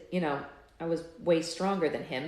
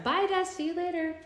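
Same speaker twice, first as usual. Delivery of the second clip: high called goodbye across the house; calling out; 10 semitones higher than usual. Real, not acted. Leaving for work, calling goodbye to Dad, high and carrying. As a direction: call it out to him as you leave.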